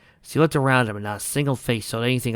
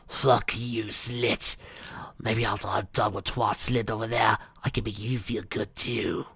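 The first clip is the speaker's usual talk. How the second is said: snarling; 5 dB quieter, slower than usual. Acted. Melody threatening Rainbow in Octavia's body. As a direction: hiss the threat as the spirit. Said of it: "Melody snarled".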